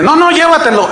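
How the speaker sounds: high, emphatic retold insistence; acted insistent urging. Friend pushing a recorded sermon on him, acted insistence.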